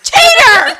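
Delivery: high, loud laughing shriek; shrieking accusation. Retold or imitated. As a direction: scream it at the loser. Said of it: "this bitch is like".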